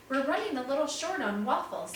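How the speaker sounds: light, cheerful offer to help. A narrator's reading. Peter tells the family about the waffles, cheerfully. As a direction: sound glad to be useful. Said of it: "he said cheerfully".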